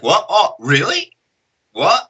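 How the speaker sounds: lightly acted high, rising questions; mock bewilderment. Dazed fighter shown his own opponent, mock bewilderment.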